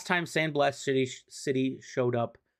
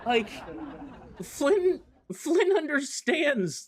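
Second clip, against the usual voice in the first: high, slow, gleeful fangirling with a laugh; gushing praise; 11 semitones higher, slower than usual. Real, not acted. Fan gushing to viewers about the writer, high and delighted. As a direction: rave about the writer you love.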